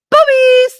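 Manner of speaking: very high, loud sudden shout; shrieked word; loud squeal. Real, not acted. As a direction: blurt it out at full volume. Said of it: "I know that came out loud".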